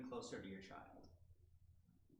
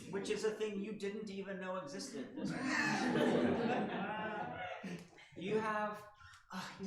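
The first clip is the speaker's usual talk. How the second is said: laughing through words; 7 semitones higher, 7 dB louder than usual. Real, not acted. Laughing at the irony.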